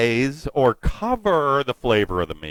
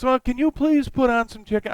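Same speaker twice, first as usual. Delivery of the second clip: imitating his daughter; 6 semitones higher than usual. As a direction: play the daughter sweetly asking Dad. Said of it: "so she goes".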